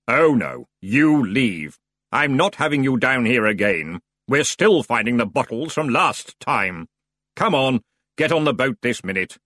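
scolding, firm; cross ferryman. Acted. The ferryman orders the Feegles onto the boat.

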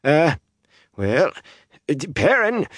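put-on halting, high scratchy old man. A narrator's reading. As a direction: hem and haw in a scratchy voice. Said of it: "he said slowly in that scratchy voice".

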